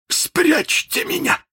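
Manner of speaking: very high, drawn-out villain's cry; frightened plea. A narrator's reading. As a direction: beg to be hidden, high and stretched. Frightened Hook begs his pirates. (испуганная мольба высоким голосом)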